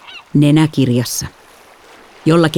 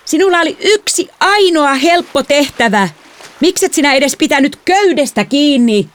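high, exasperated yelling; heated accusing shout; angry scolding; 12 semitones higher than usual. A narrator's reading. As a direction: rail at the careless sister. Shouted quarrel over drifting dinghy.

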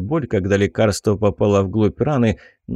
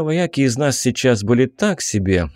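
ordinary delivery, nothing marked

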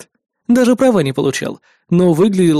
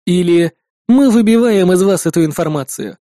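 ordinary delivery

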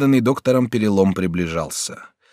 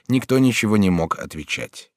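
plain reading voice

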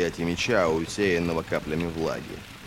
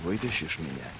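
low, hushed near-whisper; 4 semitones lower, 8 dB quieter than usual. A narrator's reading. Logen privately asking Ferro for help, whispering. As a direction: drop to a secretive whisper. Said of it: "прошептал он".

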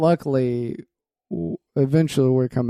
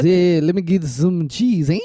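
mock French accent; 3 semitones higher than usual. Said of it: "Is that your French accent".

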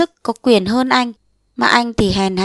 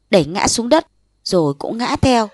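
ordinary delivery

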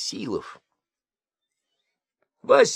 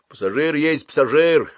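droning mumble; 6 semitones higher, 6 dB louder than usual. Acted. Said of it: "бубнил Басилов".

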